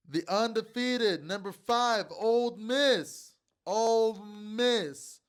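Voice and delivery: high, loud and slow, stretching every word out; game announcer hype. Acted. Host hyping the Ole Miss game to viewers, announcer-style drawl. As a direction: call it like a stadium announcer.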